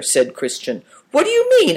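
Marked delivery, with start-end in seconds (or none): 1.1-1.8 much higher, louder stunned question; flabbergasted. A narrator's reading. Soldier stunned that the boys dislike it too. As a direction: caught completely off guard. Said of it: "asked Good Fortune, flabbergasted".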